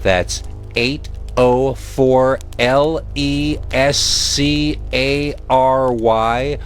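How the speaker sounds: plain reading voice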